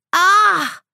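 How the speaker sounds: high mock-hurt "ah"; child's play-acted yelp; pretend cry of pain. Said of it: "cried another boy, pretending to be hurt".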